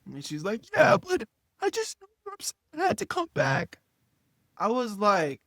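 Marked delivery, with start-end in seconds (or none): 0.7-3.8 very high, fast girlfriend imitation; mock upset girlfriend. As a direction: whine it out in a high put-on voice. Mimics upset girlfriend explaining.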